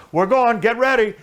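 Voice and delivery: co-driver yelling before takeoff; loud urgent heads-up; shouted warning. Retold or imitated. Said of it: "He goes".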